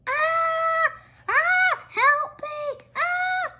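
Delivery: high piercing screams and cries for help; frightened screams. Acted. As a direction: scream as the flood takes you. Swept away screaming.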